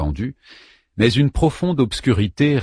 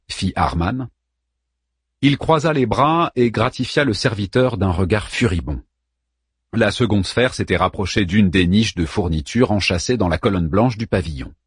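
ordinary delivery

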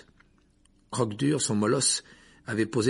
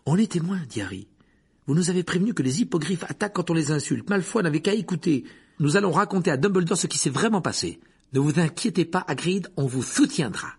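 put-on higher, eager young hero voice; a boy defending his friend; indignant boy; 8 semitones higher, 4 dB louder than usual. A narrator's reading. Harry voiced higher, determined.

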